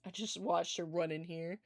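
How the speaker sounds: normal talking voice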